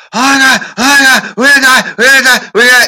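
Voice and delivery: high, loud panicked wail; panicked shrieking. Retold or imitated. Driving the mountain road, shrieking in panic at wife. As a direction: scream it like the car is going over. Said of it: "and I'm just".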